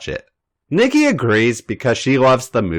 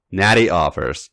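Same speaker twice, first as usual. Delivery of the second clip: ordinary delivery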